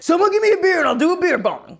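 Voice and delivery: loud, high party-guy holler; imitating an obnoxious bro's yell; rowdy party bro. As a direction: holler it like a beer-bong bro. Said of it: "he's all like".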